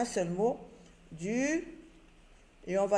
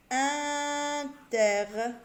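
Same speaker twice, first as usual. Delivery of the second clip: Interdit stretched very slowly, a little higher; drawn-out word; 5 semitones higher, much slower than usual. Shown as a demonstration. Teacher to pupils, drawing out the syllables slowly. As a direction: pull the syllables of Interdit apart.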